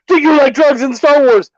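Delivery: mock screamed pitch. Co-host mimicking the screaming convention seller, mock shouted pitch. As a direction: play back a vendor's shouted question. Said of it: "he just starts screaming it at everybody".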